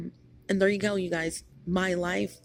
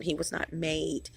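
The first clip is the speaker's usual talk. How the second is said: usual speaking voice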